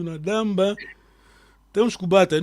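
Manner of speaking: ordinary talk, nothing marked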